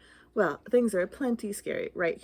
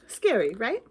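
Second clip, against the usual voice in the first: ordinary delivery, nothing marked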